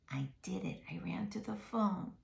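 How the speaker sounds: ordinary delivery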